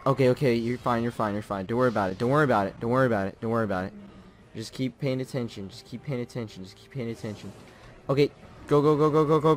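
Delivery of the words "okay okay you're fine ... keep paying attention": soft, low, soothing himself; murmured self-comfort; self-reassurance. Real, not acted. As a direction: talk yourself down quietly. Calming himself after a mistake.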